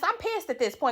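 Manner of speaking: ordinary talk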